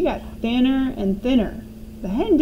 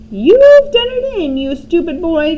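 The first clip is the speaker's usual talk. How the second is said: put-on high, loud old woman's shout; grandma yelling at her grandson; 7 semitones higher, 10 dB louder than usual. A narrator's reading. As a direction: scold the boy in a shrill old crone shout. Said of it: "Grandma shouted".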